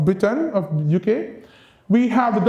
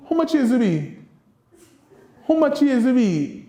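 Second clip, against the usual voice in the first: Creole question modelled for the class; Kittitian Creole; 4 semitones higher than usual. Shown as a demonstration. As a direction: give the Creole version as a teacher would. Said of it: "So we say".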